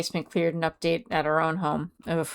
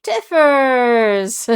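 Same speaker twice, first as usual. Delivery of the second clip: loud, high call of a name; excited greeting; 7 semitones higher, 8 dB louder than usual. Real, not acted. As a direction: call out happily to a friend.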